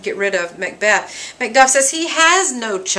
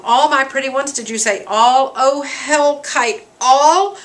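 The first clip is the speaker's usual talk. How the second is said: grief-stricken outcry; 5 semitones higher, 4 dB louder than usual. Acted. Macduff learns all his pretty ones are killed, grief-stricken outcry. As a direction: cry out in disbelieving grief, without sobbing. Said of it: "he grieves, he cries, he screams".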